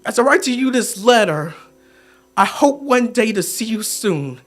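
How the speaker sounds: soft, low, pausing between phrases; hushed, heartfelt sign-off; tender sincerity. Acted. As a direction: close the letter gently and sincerely. Tender close of the letter.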